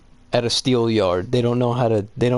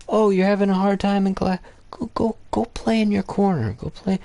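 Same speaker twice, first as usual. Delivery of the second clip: higher-pitched mocking teacher; mock condescension; 9 semitones higher than usual. Teacher sends a struggling kid to the corner, high mocking voice. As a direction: send a kid off in mock-sweet dismissal. Mock-quoting dismissive school staff.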